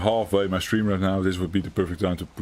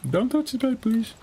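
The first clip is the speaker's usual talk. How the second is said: pleading. Real, not acted. Pleading with the game.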